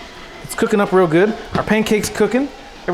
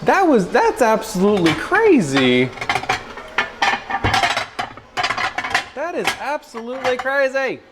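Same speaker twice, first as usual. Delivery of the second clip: high, slow, drawn-out wonder; real high-pitched amazement; amazed excitement; 8 semitones higher, slower than usual. Real, not acted. Amazed at eggs.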